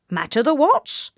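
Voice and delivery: lightly voiced quirky asking; back-to-front man. A narrator's reading. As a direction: ask it in a funny odd way. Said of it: "he asked".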